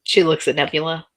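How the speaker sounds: ordinary talk, nothing marked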